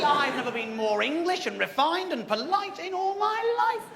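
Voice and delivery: normal talking voice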